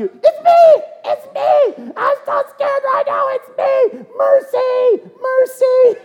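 hammed-up, very high terrified squeal; panicked confession. Churchgoer singled out before the congregation, comically panicked high pleading. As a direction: squeal for mercy like a scared coward. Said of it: "you know, like that".

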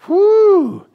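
long high exhale; whew. Real, not acted. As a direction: blow out a loud whoosh of feeling. Preacher exhales, moved.

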